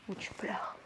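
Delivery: hushed and breathy, as if weeping; whispered. Shown as a demonstration. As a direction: whisper the words. (chuchoté)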